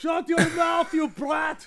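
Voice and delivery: high, shouted scold at a brat; mock yell. Grumpy uncle yelling at Yuffie, mock shout. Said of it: "just yelling at Yuffie".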